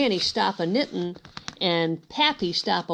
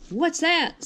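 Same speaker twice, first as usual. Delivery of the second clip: put-on high curious girl's question; little sister voice; 8 semitones higher than usual. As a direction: ask it like a startled little girl. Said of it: "Sis said".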